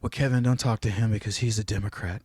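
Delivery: low, quiet, droning voice of a scolding relative; mock a relative who is warning him. Retelling a relative warning Kevin off a Democrat, low mocking drone.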